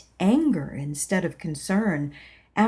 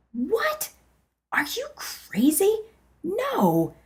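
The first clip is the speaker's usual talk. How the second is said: much higher, startled outburst; shocked disbelief; 13 semitones higher, slower than usual. Acted. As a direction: recoil in disbelief. Shocked reply to inner voice.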